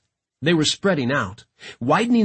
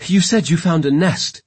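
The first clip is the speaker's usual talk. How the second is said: breathing heavily; 4 semitones higher, 5 dB louder than usual. A narrator's reading. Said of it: "breathing heavily".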